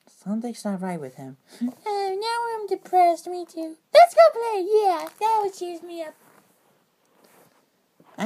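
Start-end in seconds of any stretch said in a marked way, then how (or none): 1.9-3.8 high cartoon voice. Toy characters talk, high voices. 3.9-4.6 bright, bouncy kid cartoon voice; excited high voice. Character cheers up friends. 4.7-6.1 high cartoon voice. Toy characters talk, high voices.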